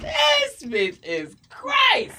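high, slow, drawn-out mock verdict; mock flabbergasted cops; imitating astonished officers. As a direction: stretch it out in hammed-up disbelief. Officers react to her tongues.

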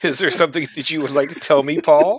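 mock interrogation. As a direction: play the stern accuser. Teasing accusation.

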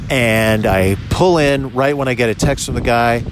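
loud, punchy voice-memo dictation; dictating. Acted. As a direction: dictate it like a log entry, full volume.

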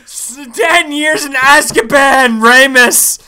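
loud, laughing hammed-up lament; laughing mock wail. Real, not acted.

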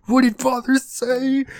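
very high, slow put-on voice; mock hysterical mother. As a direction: stretch it out in a high-pitched fit. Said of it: "she starts having fucking, like, conniptions".

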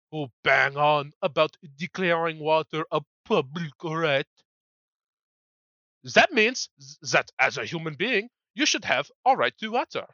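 lightly put-on French accent; bad French accent. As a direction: read the quote in a clumsy French accent. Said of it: "my really shitty French accent".

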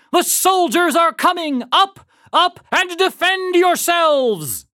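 high, ringing cry of alarm; shouting. A narrator's reading. Paul Revere warning the farmers as he rides, shouting. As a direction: shout a warning, drawing out the last words. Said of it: "shouted Paul Revere".

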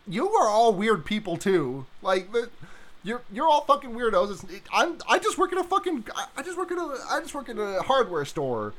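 high, laughing exasperated rant; exasperated Ben impression. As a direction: rant like a fed-up store clerk. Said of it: "Ben's like".

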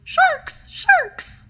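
high frantic yell of sharks; panicked krill cry. Acted. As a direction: shriek it like a terrified little krill. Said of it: "this little krill comes by and says".

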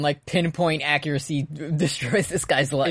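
ordinary delivery

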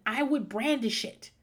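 ordinary talk, nothing marked

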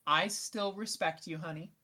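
ordinary talk

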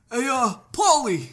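put-on New Jersey accent; Jersey thug calling. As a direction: call out to Paulie, Jersey style. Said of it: "they'd be like".

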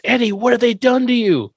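lightly voiced distraught Herman; playing Herman over his son; mock anguish. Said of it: "Herman goes".

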